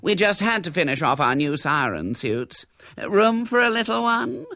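high-pitched gushing chatter; fluting, jolly lady guest; gaily. A narrator's reading. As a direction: prattle merrily on arrival. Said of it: "she said gaily as she entered".

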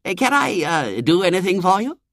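high, hesitant, languid courtesy; polite young man. A narrator's reading. Young man addressing the children, polite and puzzled. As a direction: offer help with gentlemanly dithering. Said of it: "he said politely".

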